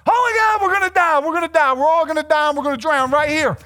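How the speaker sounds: high, loud, rapid-fire panicked cry; hammed-up terror, the words tumbling out; mock panic. Preacher voices disciples in a gnarly storm, freaking out. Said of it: "disciples are freaking out".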